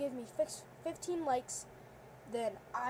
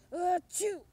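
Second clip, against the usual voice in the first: loud playful sneeze; one big sneeze; fake sneeze; 4 semitones higher, 6 dB louder than usual. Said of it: "Bulldog has the sneezes".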